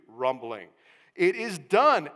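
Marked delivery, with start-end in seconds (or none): 1.1-2.1 loud, ringing declaration; exclamation point. Shown as a demonstration. Preacher building the heavenly cry before the congregation, loud. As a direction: punch it out again. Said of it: "Exclamation point number three".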